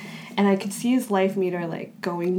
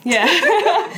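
laughing yeah; 9 semitones higher, 7 dB louder than usual. Real, not acted. Laughing agreement.

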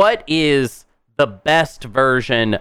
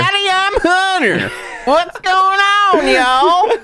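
much higher, slow hammed-up Southern drawl greeting; southern twang; slower than usual. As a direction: ham up a cowboy Southern accent. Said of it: "southern twang".

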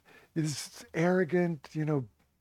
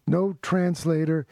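ordinary talk, nothing marked

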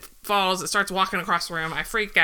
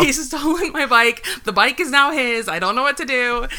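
mock panic; 4 semitones higher, 5 dB louder than usual. Retold panicked phone plea.

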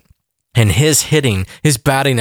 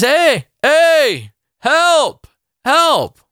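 calling for help; 13 semitones higher, much slower than usual. Acted. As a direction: shout up to a passerby, hey, help. Man in hole calls passerby.

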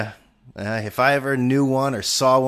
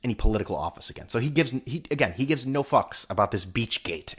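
ordinary talk, nothing marked

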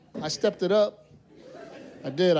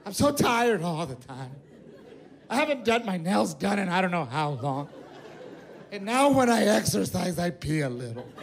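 mock crying wife. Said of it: "My wife was crying. She goes".

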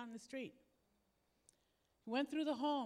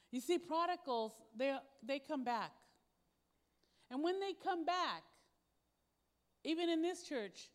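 rising sermon preaching; 3 semitones higher, 6 dB louder than usual. Acted. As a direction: preach it out, pushing each list item harder. Preaching builds to full force.

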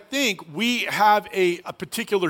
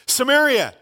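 ordinary talk

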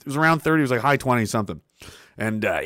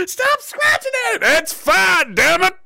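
laughing yell; slower than usual. Retold or imitated. Son shouts at mom to stop fussing, laughing yell. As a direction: snap it out half laughing.

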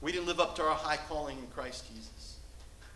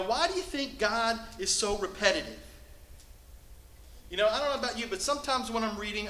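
usual speaking voice

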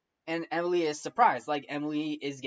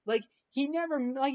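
ordinary delivery

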